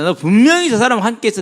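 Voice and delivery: loud, high acted accusing; preacher playing a certain accuser; insistent accusation. Voices Peter's accuser.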